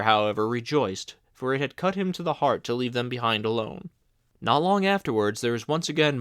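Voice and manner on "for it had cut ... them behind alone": usual speaking voice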